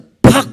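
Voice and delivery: loud, high "pac" slam; imitated crash. Preacher imitating the money changer's table overturned, loud crash sound. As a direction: bang it out like a table flipping.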